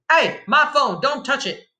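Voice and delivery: high, urgent yell; screamed protest. A narrator's reading. Child stopping bandit grabbing phone, high screamed protest. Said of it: "I screamed".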